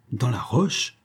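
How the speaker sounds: a mildly astonished echo, rising at the end; light disbelief at roche; surprised. A narrator's reading. Doctor and commandant echoing Jacques, mildly surprised. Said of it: "s'écrièrent surpris".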